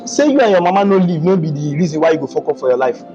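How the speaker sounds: ordinary talk, nothing marked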